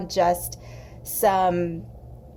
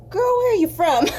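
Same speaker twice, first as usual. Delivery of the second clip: higher, louder mock trucker voice; curious trucker; 10 semitones higher, 6 dB louder than usual. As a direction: call out like a staring trucker at the counter. Trucker stares and asks her.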